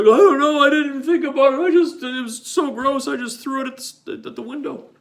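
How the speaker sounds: high, fast flustered excuse; flustered friend. Retold or imitated. Friend excusing himself to the driver, high and flustered. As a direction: blurt the excuse in a panic. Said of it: "Brian's like".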